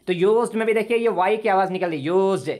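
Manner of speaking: ordinary talk, nothing marked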